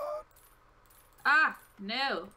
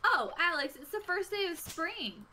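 higher, eager burst of news; excited announcement; 8 semitones higher than usual. Real, not acted. Player alerts Alex to spring's arrival, excited. Notices spring has begun in game.

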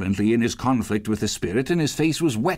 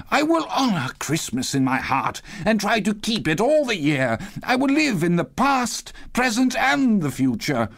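agonised entreaty; 8 semitones higher than usual. A narrator's reading. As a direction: plead as a desperate Scrooge. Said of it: "In his agony he caught".

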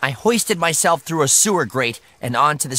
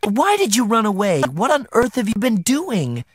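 high, exasperated questioning; pitched-up, agitated scolding; crying out; 6 semitones higher than usual. A narrator's reading. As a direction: demand answers like an upset mother. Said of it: "she cried".

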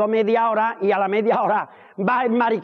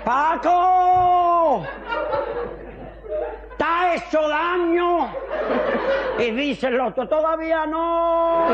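comic character voice; 8 semitones higher, much slower than usual. Acted. Painter calling down to his fallen mate, high and laughing. Falling painter joke dialogue.

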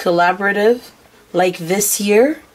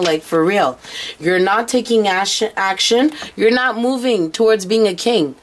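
normal talking voice

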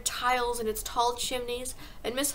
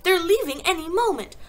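high, loud warning; pitched-up, pressing alarm; urgent cry; 7 semitones higher, 7 dB louder than usual. A narrator's reading. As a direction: cry it out as a frantic child. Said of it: "Matilda cried".

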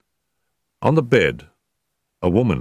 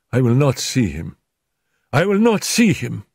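second take higher and sharper; feverish refusal; 7 semitones higher than usual. Acted. Feverish wife refusing to see her husband, rising. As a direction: refuse him, rising to a cry.

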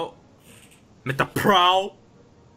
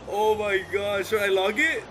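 ordinary talk

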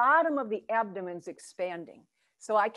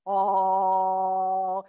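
louder held oh resonating from the belly; hara voice vowel; 2 semitones lower, 5 dB louder than usual. Shown as a demonstration. Teacher demonstrating a hara vowel for students, held belly-resonant oh. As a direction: sing out oh as if your belly had a mouth. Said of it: "As if the hara could speak".

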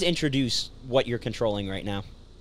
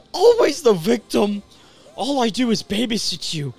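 acting out character lines; earnest video game character; dramatic game dialogue; 9 semitones higher, 3 dB louder than usual.

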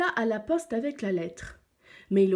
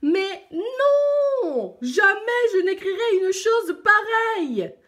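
very high, loud, outraged roar; enraged lion; 12 semitones higher, 8 dB louder, slower than usual. A narrator's reading. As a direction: explode into a roar of refusal. Lion enraged, fou de rage. (rugissement fou de rage)

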